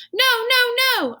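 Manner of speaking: very high, loud squeaking no; squeaky protest. Acted. Hamster begging the janitor not to switch off the light. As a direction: squeak in panic. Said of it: "I squeaked".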